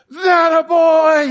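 high, drawn-out proud yell; dad screaming. Retold or imitated. Dad cheering his kid's basket, screaming. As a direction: holler it long and loud like a cheering father. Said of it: "and screaming".

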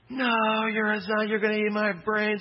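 put-on high, loud squeal of a thrilled three-year-old; excited little kid. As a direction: squeak it out like a kid who loves being chased. Child voiced in the story.

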